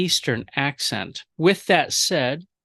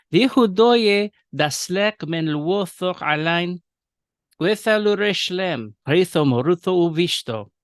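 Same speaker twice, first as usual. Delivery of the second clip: Western Syriac accent; 3 semitones higher than usual. Shown as a demonstration. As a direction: read Syriac in the Western accent, rounded o vowels. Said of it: "read the Syriac first in the Western accent".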